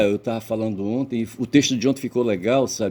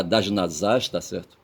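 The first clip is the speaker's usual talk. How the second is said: ordinary talk, nothing marked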